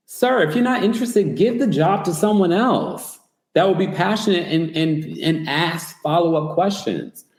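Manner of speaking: high-pitched, indignant address to someone falling short; exasperated plea. Acted. Commentator imagining telling off an uninterested reunion host, exasperated plea. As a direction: plead the case, starting high and easing down.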